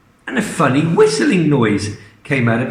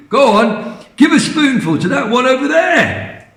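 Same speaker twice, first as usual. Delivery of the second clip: much higher, pointing eagerly; excited Mister Cranky; 10 semitones higher than usual. Acted. Mister Cranky showing George which hen, excited high voice. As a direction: point the hen out, all worked up.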